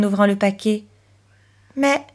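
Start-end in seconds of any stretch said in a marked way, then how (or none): 1.8-2.0 put-on surprised little girl, higher pitch; surprised exclamation. A narrator's reading. Chloé unwrapping a gift from her aunt, surprised. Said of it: "s'écria-t-elle surprise".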